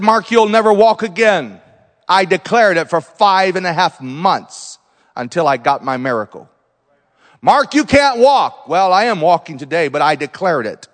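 ordinary talk

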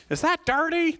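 high, mock fussy wife voice; imitating wife. Pastor imitates his wife Heather fretting, high-pitched mock. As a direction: play Heather fretting over the blanket. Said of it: "that's not her voice".

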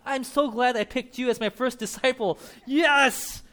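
high, loud gleeful thought; delighted cheer. Acted. Jesus delighted with his first disciple, high and loud. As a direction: gush with delight.

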